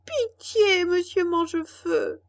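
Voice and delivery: high, loud tearful begging; wailing supplication; pleading voice. A narrator's reading. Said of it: "d'une voix suppliante".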